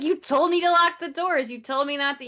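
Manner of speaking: retold argument, voiced with mild indignation; mock indignant. Arguing with him through her car window, mock indignant. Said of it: "she's mad at him. He's mad at her".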